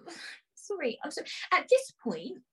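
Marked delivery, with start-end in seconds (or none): none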